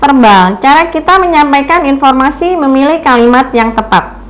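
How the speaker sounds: mock nervous student reading from a script; imitating a student's reading voice. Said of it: "mahasiswa-mahasiswi saya yang suaranya seperti itu".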